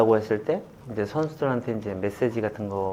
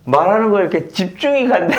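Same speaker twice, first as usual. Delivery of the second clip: excited retelling; 9 semitones higher, 6 dB louder than usual. Retold or imitated. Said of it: "그런 얘기를 직접 하는 거예요".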